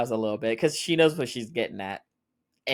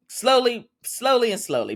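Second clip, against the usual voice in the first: excited gushing; 12 semitones higher, 5 dB louder than usual. Real, not acted. Thrilled walls are coming down.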